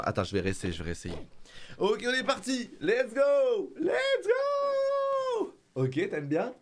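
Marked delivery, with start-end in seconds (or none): 1.8-5.5 high-pitched cheer, climbing higher; playful falsetto rallying cry; excited hype. Real, not acted. Hyping the baby up.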